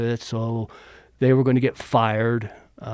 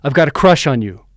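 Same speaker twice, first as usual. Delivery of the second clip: louder and faster, a sudden blurt; blurted confession; 2 semitones higher, 9 dB louder, faster than usual. Retold or imitated. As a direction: blurt it out all at once. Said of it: "She blurts out".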